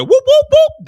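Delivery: very high, loud imitated siren whoops; police siren. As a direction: wail it like a squad car siren. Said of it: "That's the sound of the police".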